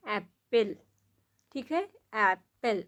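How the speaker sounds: "एप्पल" said slowly and carefully, with a short "ए"; saying apple. Shown as a demonstration. Teacher showing parents how apple sounds, careful. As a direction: sound out "एप्पल" clearly for a child. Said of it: "जैसे एप्पल बोलते हैं".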